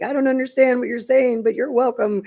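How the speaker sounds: retold in a high, emotional voice; high-pitched gushing reply. Finder answers family she can't understand, high-pitched gushing. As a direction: pitch way up, as if overcome by their thanks.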